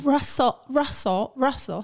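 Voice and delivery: playful British-accent attempt; Russell Brand. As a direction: try out a British accent on the name. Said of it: "Why aren't we doing Russell Brand".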